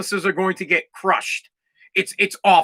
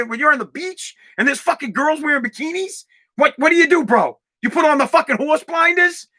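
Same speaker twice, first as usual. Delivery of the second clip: higher, disbelieving cross-examination; incredulous challenge; 6 semitones higher than usual. Acted. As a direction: grill him in mock disbelief. Acted incredulous challenge to debate opponent.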